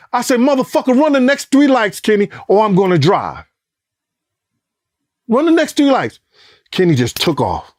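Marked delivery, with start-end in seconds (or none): 0.4-6.2 pitched way up, urgent and insistent; frantic yelled order. Retold or imitated. Ordering Kenny to run the lights, high frantic yell. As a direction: bark the order at the driver like everything depends on it.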